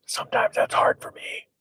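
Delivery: hushed, voiceless confession; whispered aside. Real, not acted.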